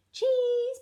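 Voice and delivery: high, loud little piglet; little Peppa. A narrator's reading. Peppa hands Grampy Rabbit his order, high piglet voice. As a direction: announce it in a high child's voice.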